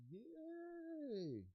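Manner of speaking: very high, drawn-out cheer; mock cheer. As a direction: squeal with delight. Celebrates finally doing it.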